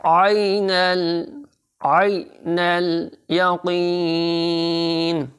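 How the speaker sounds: आइनल यकीन with the या drawn out; या को खींच कर. Shown as a demonstration. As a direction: stretch the या in यकीन slowly. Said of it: "या को थोड़ा सा खींचेंगे". (यकीन की या खींच कर)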